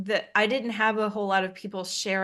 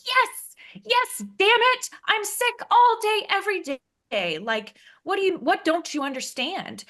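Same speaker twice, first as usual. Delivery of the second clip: loud, high, fed-up shout at mom; exasperated yell; 12 semitones higher, 4 dB louder than usual. Retold or imitated. Venting to her mom about constant sickness, exasperated high yell. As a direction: yell it out, sick of the question.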